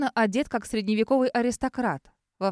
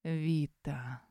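низко, тихо, пробуя на вкус; протянуть имя, как будто пробуешь; смакуя имя; 6 semitones lower, 8 dB quieter than usual. Acted. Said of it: "Словно пробует моё имя на вкус". (смакуя, низким голосом)

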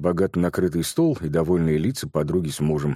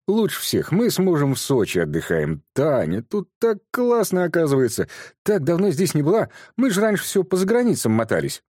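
высоким, довольным голосом хвастаться; звонко, с самодовольством; хвастливая подруга; 10 semitones higher than usual. A narrator's reading. (подруга хвастается отдыхом в Сочи)

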